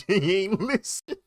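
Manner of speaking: high, loud words through real laughter; laughing. Real, not acted. Friend laughing that he ignored Monique's advice, laughing loudly. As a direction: stretch it out while cracking up.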